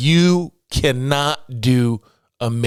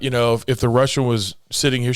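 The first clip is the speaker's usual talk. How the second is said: ordinary talk, nothing marked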